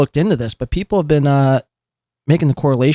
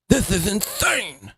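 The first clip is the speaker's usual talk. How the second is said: slow, softer mimic of "This is insane"; mocking slow repeat; 4 semitones higher, 5 dB quieter, slower than usual. Host parroting the streamer's line, slow and soft. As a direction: echo it back slowly in mockery. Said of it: "you repeating the words".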